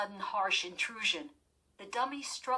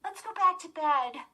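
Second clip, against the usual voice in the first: pleading; 11 semitones higher, 7 dB louder than usual. A narrator's reading. Lindy begging her sister.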